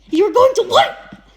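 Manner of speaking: high, loud, slow disbelieving shout; outraged bellow at the confession. A narrator's reading. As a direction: yell it out in shock, dragging it. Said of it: "Rick shouted".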